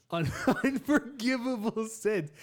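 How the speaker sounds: high, loud words through laughter; laughing too hard to stay in role; breaking into laughter. Real, not acted. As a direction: crack up halfway through the line. Cracks up voicing God.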